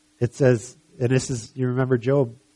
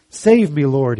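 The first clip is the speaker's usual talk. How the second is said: sudden loud, high cry for help; shouted; 3 semitones higher, 8 dB louder, slower than usual. Acted. Peter sinking in the waves, loud high shout to Jesus. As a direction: shout it out within a read story. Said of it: "he shouted".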